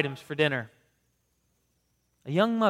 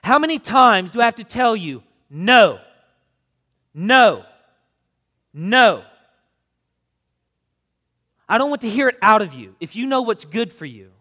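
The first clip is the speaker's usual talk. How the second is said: loud, high angry scolding; mother yelling at her fussing son over candy; lit into him; 7 semitones higher, 11 dB louder than usual. A narrator's reading. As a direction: yell at the child in full fury. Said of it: "She lit into him".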